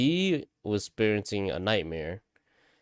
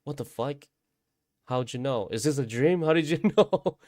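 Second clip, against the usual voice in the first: high, incredulous questions climbing louder; acted disbelief; 6 semitones higher, 4 dB louder than usual. Dreamer asking brother how he knew, acted disbelief. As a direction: re-enact astonishment, pitch rising to the last question. Retold shock at brother's knowledge.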